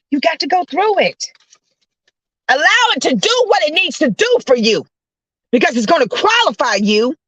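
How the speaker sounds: high-pitched, emphatic exhortation; fervent preaching. Real, not acted. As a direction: preach it with rising urgency.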